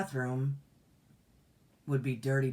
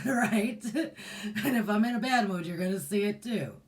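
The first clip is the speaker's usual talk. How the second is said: higher-pitched, talking through a laugh; 7 semitones higher, 3 dB louder than usual. Real, not acted. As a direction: let the laugh carry into the words. Laughs at her own joke.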